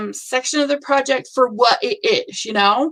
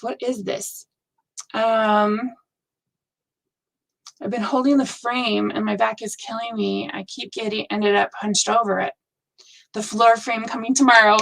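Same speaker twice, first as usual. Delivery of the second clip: ordinary talk, nothing marked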